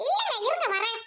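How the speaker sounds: haughty sister scolding at her gate; snobbish rich sister. Acted. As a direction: talk down to the noisy crowd at the gate. Sister hides brother from husband.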